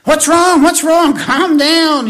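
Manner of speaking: high, loud retold alarm, pressing to calm her down; a pastor startled by an upset member, asking high and loud; alarmed what's wrong. Pastor retells calming upset member.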